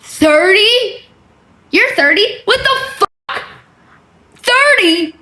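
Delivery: high, stunned disbelief; can't believe she's thirty; incredulous. Real, not acted. Reading her age aloud, high incredulous disbelief.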